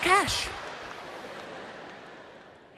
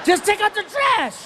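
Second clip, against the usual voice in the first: higher, pushed exasperated command; 10 semitones higher, 3 dB louder than usual. Retold or imitated. Dad fed up with his son, exasperated order. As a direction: snap it out fed up.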